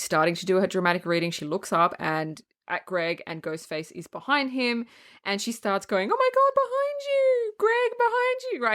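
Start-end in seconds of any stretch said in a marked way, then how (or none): 6.1-8.6 mock screaming. Buffy warning Greg from the stage, mock screaming. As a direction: imitate a pageant girl's panicked cry without full volume. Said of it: "she starts crying and screaming".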